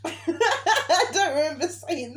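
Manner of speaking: laughing. Real, not acted. Laughing at the retelling.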